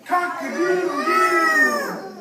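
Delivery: very high, loud, long crow; rooster crow. Acted. Teacher leading children in rooster sounds, shrieked crowing. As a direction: crow at full voice, stretching the end. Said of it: "A rooster says".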